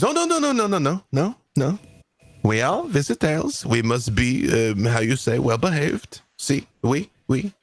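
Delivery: panicked French gentleman smoothing things over; frantic French placating. Acted. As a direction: hurry to calm things, squeaking in French accent.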